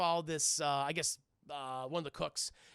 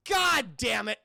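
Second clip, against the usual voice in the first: frustrated curse; 4 semitones higher, 8 dB louder, slower than usual. Acted. Batman finds the dummy.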